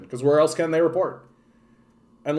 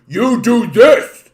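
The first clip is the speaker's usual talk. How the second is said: much higher, louder, slow thug bark; crazy thug; 8 semitones higher, 10 dB louder, much slower than usual. Retold or imitated. Bully pointing at everyone, barking orders loudly. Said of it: "a big fucking crazy thug ... goes".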